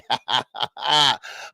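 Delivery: short laugh at own slip; amused laugh. Real, not acted. Host laughing after correcting drive to fly safely, amused laugh. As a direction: laugh off the mistake.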